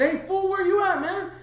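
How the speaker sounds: very high, hammed-up demand; retold calling out. Phoning a missing friend, urgently calling out. Acted phone call hunting lost friend.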